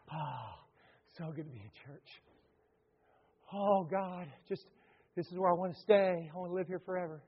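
soft, hushed mock rapture; mock blissful worshipper. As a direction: sigh it like a contented churchgoer. Mimics churchgoers in holy huddle.

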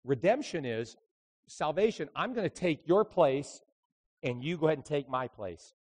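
normal talking voice

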